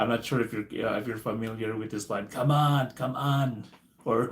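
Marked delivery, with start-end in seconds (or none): 2.3-3.6 Arnold Schwarzenegger impression. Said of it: "Darnest Arnold Schwarzenegger impression".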